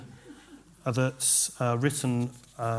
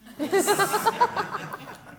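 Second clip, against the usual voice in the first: burst of laughter; 6 dB louder than usual. Real, not acted. Audience laughs at pun.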